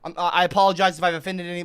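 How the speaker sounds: usual speaking voice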